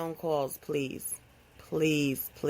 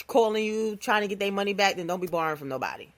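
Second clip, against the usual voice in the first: usual speaking voice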